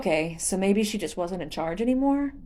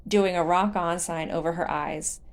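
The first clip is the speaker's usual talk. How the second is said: usual speaking voice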